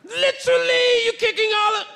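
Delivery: high-pitched bad British accent; hammed-up British accent with a squeak. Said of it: "I don't do a good British accent".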